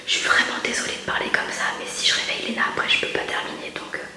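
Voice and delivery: chuchote pour ne pas réveiller le bébé; voix chuchotée, très basse et douce; chuchotement. Real, not acted. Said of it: "je parle pas trop fort pour pas que Léna se réveille". (chuchote tout doucement)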